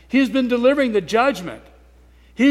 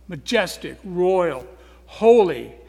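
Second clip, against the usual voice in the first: ordinary talk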